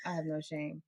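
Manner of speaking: usual speaking voice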